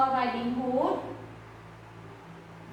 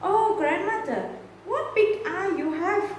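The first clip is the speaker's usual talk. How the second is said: put-on girl's voice; 6 semitones higher, faster than usual. A narrator's reading. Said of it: "she said next".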